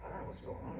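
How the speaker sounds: low, soft, shrugging thought; hushed, low guess to himself; musing to himself. Retold or imitated. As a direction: think it aloud, barely voiced. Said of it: "so I'm like".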